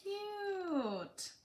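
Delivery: high, slow cooing over something cute. Real, not acted. Cooing at pets to child.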